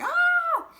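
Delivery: very high, loud exasperated shriek; a burst of mock frustration; frustrated scream. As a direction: let out a snapping-point scream. Frustrated parent at breaking point.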